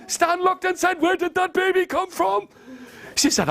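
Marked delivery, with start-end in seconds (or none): 1.0-2.5 high-pitched alarmed question; startled. Acted. Startled husband asking his wife about the baby, high and alarmed. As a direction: squeak it out in disbelief. Stan shocked by sudden baby.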